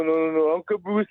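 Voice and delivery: ordinary talk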